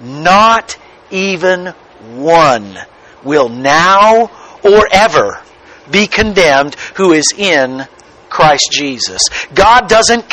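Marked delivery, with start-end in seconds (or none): 0.0-9.2 phrase by phrase, letting "Not even one" land; emphatic repeat. Shown as a demonstration. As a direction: repeat it deliberately, pausing after "one". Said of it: "Did you hear that?".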